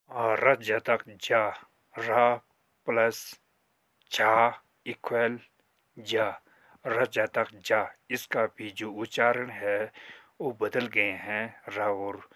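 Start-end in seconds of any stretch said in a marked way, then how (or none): 0.1-7.9 र जतक जा, slowly, syllable by syllable; slow demo reading. Shown as a demonstration. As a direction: sound it out for the class. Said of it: "करके पढ़ते हैं". (धीमे-धीमे अक्षर जोड़कर पढ़ना)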